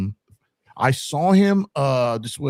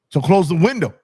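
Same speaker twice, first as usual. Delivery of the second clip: louder, higher insistence; demanding; 6 semitones higher, 6 dB louder than usual. Retold or imitated. Pressing the marshal to close it, louder. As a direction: push the point home. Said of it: "Fair point".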